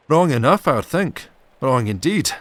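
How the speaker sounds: high, loud grumbling retort. Acted. Alan grumbling to himself, loud ill-pleased. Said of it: "kept grumbling to himself".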